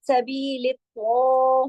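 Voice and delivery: slow, stretched Quranic chant with the ta held heavy; drawn-out tajweed recitation. Shown as a demonstration. Teacher showing students how to recite, slow and drawn. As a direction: stretch each sound like careful recitation. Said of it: "इसको लंबा नहीं खींचना".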